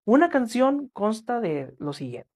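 usual speaking voice

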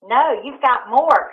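usual speaking voice

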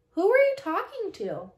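sleepy, slurred high voice; half-asleep slurring little sister; slurry voice. Acted. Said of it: "said Robin in a slurry voice".

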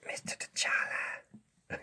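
hushed puppet whisper, drawn out slowly. Pretend. SpongeBob secretly calls to the teacher, whispering. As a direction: whisper to get someone's attention. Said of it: "why are we whispering?".

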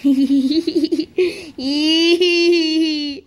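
long run of high giggles; silly giggling laugh. Pretend. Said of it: "we're laughing because it's funny".

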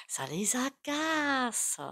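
slow breathy whisper, drawn out. Real, not acted. To viewers, about those stuck: slow whisper. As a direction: let it slip out as a slow secret whisper.

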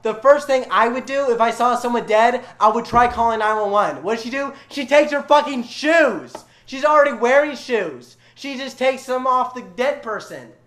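loud, high-pitched exasperation; outraged, emphatic storytelling; indignant rant. Real, not acted. Ranting about Dorothy's shoe theft.

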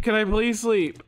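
ordinary talk, nothing marked

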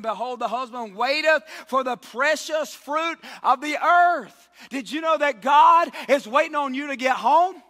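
preacher climbing to a roar; full-force preaching. Acted. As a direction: build it up and shout the verse.

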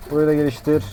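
ordinary talk

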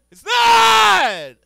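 high, loud shout. Acted. Comic ranting at greedy parents, loud indignant shout. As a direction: yell it out in mock outrage.

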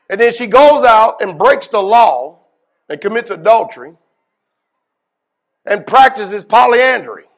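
fiery sermon rising in heat; full-force preaching. Acted. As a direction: preach it hard to the congregation.

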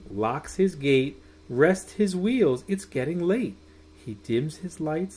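put-on lilting storybook voice for children; sing-song bedtime reading; reading a bedtime story. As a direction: read it like tucking kids in. Reads bedtime picture book aloud.

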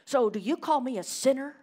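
accusing professor. Retold or imitated. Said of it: "she went down this direction".

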